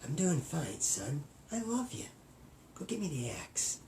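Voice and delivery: fading from soft to a whisper; mock creepy whispered threat; creepy hush. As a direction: creep the son out, dropping to a whisper. Creepy Shining dad to son.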